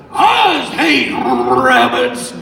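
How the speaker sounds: high, loud, slow cowboy shout; Yosemite Sam. Shown as a demonstration. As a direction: push it into a wild cartoon bellow. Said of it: "as a Yosemite Sam".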